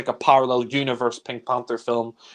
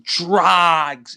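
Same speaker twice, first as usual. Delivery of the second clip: "drags" stretched out very slowly; long, laboured emphasis on "drags"; stress on drags; 3 semitones higher, 5 dB louder than usual. Real, not acted. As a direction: draw out "drags" to show the tedium. Complaining about the slow pacing.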